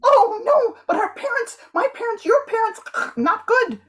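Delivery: high, overwrought fretting; hammed-up lover in a high voice; melodramatic panic. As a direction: squeal the worry like a tragic hero.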